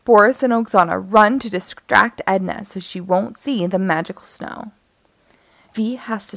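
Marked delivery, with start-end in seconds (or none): none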